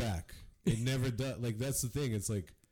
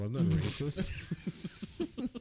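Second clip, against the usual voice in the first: very slow, higher drawn-out denial; mock bigot; 6 semitones higher, much slower than usual. Mocking people who say it, slow high mock denial. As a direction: parody a bigot's excuse.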